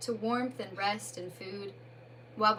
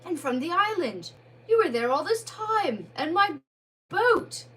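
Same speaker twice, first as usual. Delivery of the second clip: a hint of a different Rat voice, louder; lightly voiced Rat; 7 semitones higher, 7 dB louder than usual. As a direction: Rat peers at the shape, unsure.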